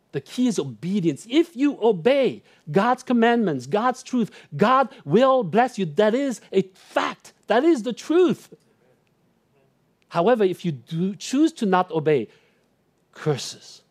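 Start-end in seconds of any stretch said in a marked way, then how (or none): 0.1-8.5 higher and louder, hammering each claim; full-force preaching. Acted. Preaching the key point. 13.1-13.8 ominous hush. Acted. Warning of consequence after build-up.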